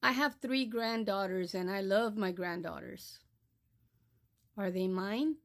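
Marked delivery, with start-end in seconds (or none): none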